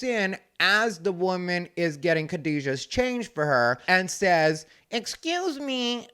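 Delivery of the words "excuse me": high, slow, soft and prissy; Shay's voice. Retold or imitated. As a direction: lift it high and draw it out. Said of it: "That's the way Shay talked".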